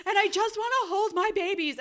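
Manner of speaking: high, loud, whiny retold plea; pleading new mother. Shivering mother asking for her babies, pleading.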